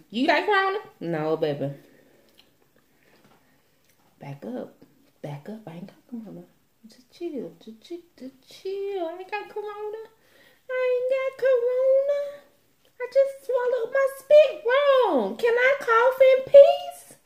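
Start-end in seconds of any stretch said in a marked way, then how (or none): 0.1-0.8 high, loud nosy question; suspicious high voice. Retold or imitated. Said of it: "everybody just". 4.2-6.4 hushed. Acted. Fends off people, hushed. 6.9-17.2 hammed-up whimpering protest; whiny high voice. Pleads in high voice.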